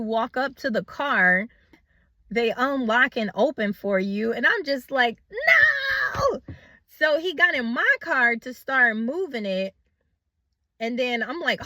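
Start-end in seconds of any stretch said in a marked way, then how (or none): 5.3-6.4 sharp high cry of No!; shouted no. Retold or imitated. Woman yelling No! as a man takes her car. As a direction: yell No! as he gets in your car.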